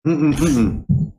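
humming through a mouthful of water; mouth full. Real, not acted. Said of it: "Sorry, I'm drinking some water".

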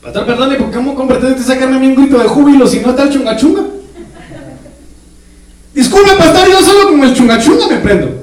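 voz aguda de feligrés reclamando, entre risas; hermano quejoso. Acted. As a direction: imita a un hermano que pide música. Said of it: "imita a un hermano quejándose". (hermano reclamando con voz aguda y burlona)

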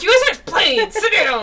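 mock telling-off of rowdy moms; exasperated scolding.

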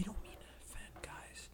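soft, unvoiced whisper apology. Real, not acted. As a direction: whisper it, like a secret aside. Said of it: "Why are you whispering?".

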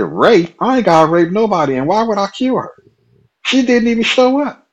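high, loud outraged denial. Retold or imitated. To the coworker who suggests he hurt the girl, outraged rising denial.

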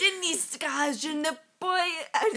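French. Pretend. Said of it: "Start speaking French".

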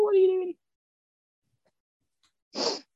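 ordinary talk, nothing marked

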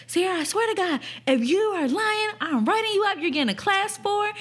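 high-pitched imitation of a scolding house parent. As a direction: lay down a threat as the exasperated supervisor. House parent threatening the prankster.

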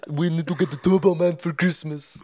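bad Arnold doing the movie dad; Arnold impression. Friend imitating Arnold's movie dad for his cohost, hammed up. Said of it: "That doesn't sound anything like, like Arnold".

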